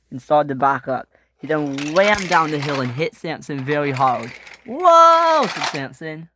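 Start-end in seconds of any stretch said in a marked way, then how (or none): 4.7-5.5 long high, loud whoa; alarmed yell. Acted. Samson hit very hard, alarmed yell.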